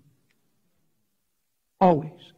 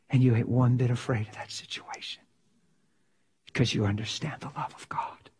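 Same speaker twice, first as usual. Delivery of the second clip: hushed whisper; 2 semitones lower, 4 dB quieter than usual. Real, not acted. Intimate reflection on fearless love.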